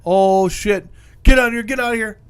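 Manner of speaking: mock alarm. Crew finds a whale instead, mock alarm. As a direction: shout a hammed-up retreat. Crew voiced fleeing the shark.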